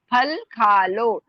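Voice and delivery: फल खा लो with aspirated फ and ख, slow. Shown as a demonstration. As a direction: stretch फल खा लो and puff out फ and ख. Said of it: "उसको हम भारी बोलेंगे". (धीरे, भारी फ और ख के साथ)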